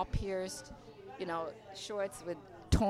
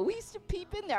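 much higher mock-guilty confession; light hammed-up grown man admitting it; imitating the peeping guys again; 12 semitones higher than usual. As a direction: toss it off in a raised, playful voice. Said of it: "We go".

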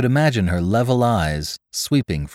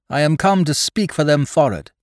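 character voice; 8 semitones higher, 3 dB louder than usual. A narrator's reading. Sailor Spry speaks up.

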